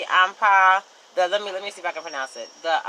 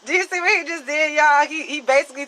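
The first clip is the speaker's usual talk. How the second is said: amused exclaim; 10 semitones higher, 4 dB louder than usual. Real, not acted.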